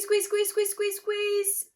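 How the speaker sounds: ordinary delivery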